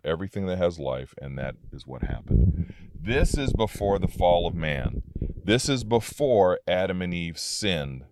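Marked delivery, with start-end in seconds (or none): none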